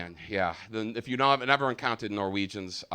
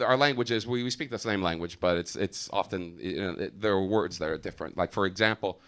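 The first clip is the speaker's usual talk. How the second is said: ordinary delivery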